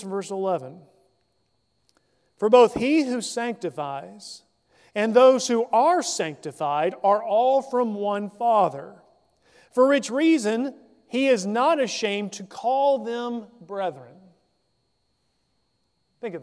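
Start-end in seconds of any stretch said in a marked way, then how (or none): 2.4-14.2 measured, solemn Bible reading, a touch louder; formal scripture read. Acted. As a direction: read the verse out to the congregation. Reads scripture passage aloud.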